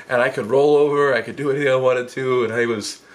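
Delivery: usual speaking voice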